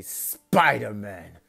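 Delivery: put-on slow, loud Russian-accented growl of the name. Rhino sizing up Deku, slow loud Russian accent. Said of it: "try my best to do a Russian accent".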